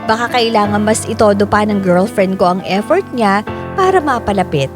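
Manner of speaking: ordinary talk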